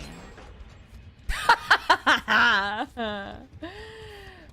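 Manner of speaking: long, high, loud cackling laugh; loud burst of laughter. Real, not acted. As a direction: burst out laughing and let it run on. Laughs at own impression.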